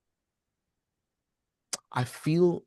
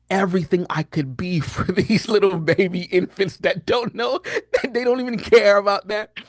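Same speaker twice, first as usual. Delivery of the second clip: laughing while talking; 7 semitones higher, 4 dB louder than usual. Real, not acted. Dad laughing at his efforts for oblivious babies, amused. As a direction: voice climbing high through real laughter.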